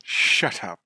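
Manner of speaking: low, deadpan dismissal; deadpan shut up. Pretend. Co-host echoes the author's answer, low deadpan. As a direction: drop it low and dry.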